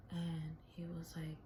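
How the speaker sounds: normal talking voice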